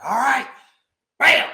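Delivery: a sudden loud bam; loud slam. Retold or imitated. Retelling the boss dropping the book, shouted. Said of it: "She's like".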